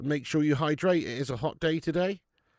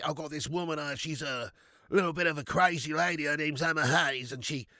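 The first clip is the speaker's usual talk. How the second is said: Big Ange. Pretend. Streamer doing an Aussie pundit on request, Australian impression. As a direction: play a broad Aussie football manager. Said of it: "Do an Aussie impression?".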